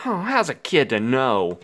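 plain reading voice

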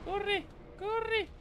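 high frantic yells; shouting orders over battle. Real, not acted. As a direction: scream at yourself to run. Fleeing the boss mid-fight.

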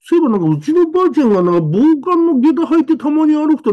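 思い出して声が高く弾む; high, quick flash of memory; excited. Retold or imitated. Child excitedly recalls grandma's geta. (祖母の下駄を思い出し、高く興奮した声で)

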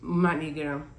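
talking between bites; chewing while talking. Real, not acted. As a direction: mouth full of mochi. Seen 1: Speaker holds bitten mochi, mouth closed and chewing between short remarks.

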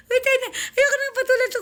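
high, loud, breaking into laughter; can't hold back the laugh; laughing outburst. Real, not acted. Napahalakhak habang nagkokomento. (malakas na tawa habang nagsasalita)

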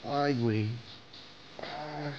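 ordinary delivery

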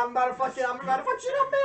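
hammed-up furious knight; mock rage. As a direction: blow up like a hothead. Gregor voiced threatening.

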